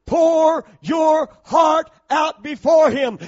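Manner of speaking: high, slow shouted phrase; loud shout. Real, not acted. Shouted first take.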